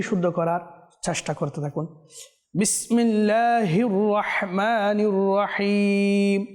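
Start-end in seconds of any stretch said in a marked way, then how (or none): 2.6-6.5 slow, drawn-out melodic tajweed chant; Quran recitation. Shown as a demonstration. Teacher reciting a verse for viewers to follow, slow melodic chant. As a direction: chant each syllable long and measured.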